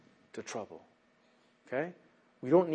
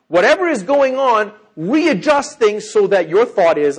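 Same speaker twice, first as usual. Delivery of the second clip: loud, higher, slowing preaching push; forceful exhortation; 6 semitones higher, 10 dB louder than usual. Real, not acted. Preacher urges congregation to readjust their thinking, forceful exhortation. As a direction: drive it home at full voice.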